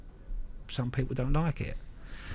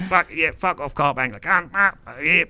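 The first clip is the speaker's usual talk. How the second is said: higher, louder garbled mock rant; mocked ranting oddball; 7 semitones higher, 6 dB louder than usual. Teller lightly mimics canoe guy swearing back, higher and louder. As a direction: lightly send up a muddled local.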